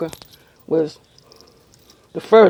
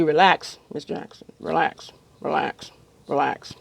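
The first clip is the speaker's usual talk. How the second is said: soft, calm dentist voice, softer on each relax; soothing dentist; 4 semitones lower, 5 dB quieter than usual. Retold or imitated. Dentist soothing anxious patient, soft and calm. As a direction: coax her gently into relaxing.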